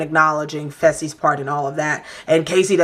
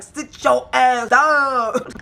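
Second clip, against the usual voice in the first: mock yell; 6 semitones higher, much slower than usual. Housemate ordering rival off his chair, mock yell. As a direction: stretch every word in an imitated outburst. Acting out Gabo's yell at Huey.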